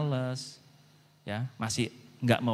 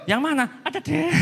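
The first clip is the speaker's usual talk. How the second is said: coy dodge; 13 semitones higher than usual. Retold or imitated. Teasing evasive friend.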